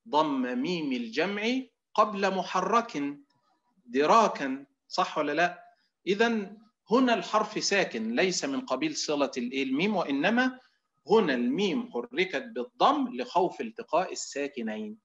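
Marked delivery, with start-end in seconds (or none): none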